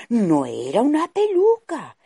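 high, loud shock; surprised. A narrator's reading. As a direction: gasp it out in alarm. Discovers the witch is real.

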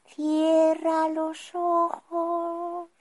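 high, very slow spell command; slow wizard voice. Acted. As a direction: draw it out like a gentle spell. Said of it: "wizard casts spell slowly".

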